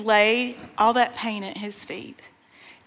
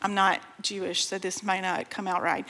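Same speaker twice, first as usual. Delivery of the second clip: softer, lower apologetic aside; quiet aside; 4 semitones lower, 7 dB quieter than usual. Real, not acted. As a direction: drop the voice for a disclaimer. Apologetic aside about her pronunciation.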